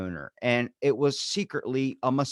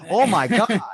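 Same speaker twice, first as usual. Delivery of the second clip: mock shocked listener; 8 semitones higher, 7 dB louder than usual. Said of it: "everything is like".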